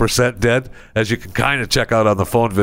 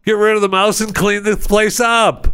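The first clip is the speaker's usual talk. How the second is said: exasperated scolding; 10 semitones higher, slower than usual. Acted. Scolding useless employees.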